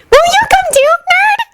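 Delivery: put-on very high sweet little girl; sweet innocent child. A narrator's reading. Kirimi inviting Haruhi, very high sweet child voice. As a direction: innocent squeaky child asking. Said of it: "very sweetly and innocently".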